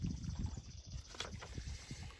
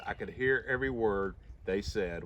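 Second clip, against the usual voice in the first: usual speaking voice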